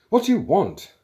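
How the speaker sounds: high, loud demand to know; angry. A narrator's reading. Programmer questioning men who burst in, angry. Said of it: "rising angrily from his seat".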